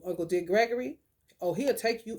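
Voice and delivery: normal talking voice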